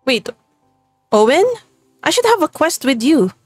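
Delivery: ordinary talk, nothing marked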